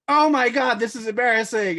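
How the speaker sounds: high, loud flustered cry; embarrassed panic. Retold or imitated. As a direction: panic about the blunder.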